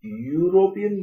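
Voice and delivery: European stretched slowly on a high pitch; drawn-out example word. Shown as a demonstration. Teacher sounds out the example word.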